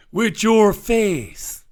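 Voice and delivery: drawn-out mock insult; mocking quote.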